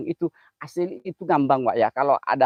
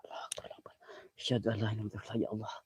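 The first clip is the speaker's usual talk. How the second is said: whispered prayer; 6 semitones lower, 15 dB quieter, much faster than usual. Real, not acted.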